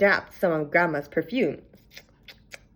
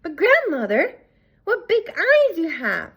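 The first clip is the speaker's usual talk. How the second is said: put-on high, slow child's voice, louder; wide-eyed little girl; curious girl; 10 semitones higher, 4 dB louder, slower than usual. A narrator's reading. The girl marvels at her grandmother's ears in a high child's voice.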